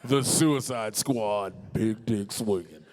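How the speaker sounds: slow, dramatic title drop; movie trailer announcer. Acted. Announces joke title slowly.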